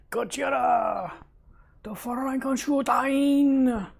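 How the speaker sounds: high, slow dramatic shout; dramatic monster call. Pretend. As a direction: drag out the monster names grandly.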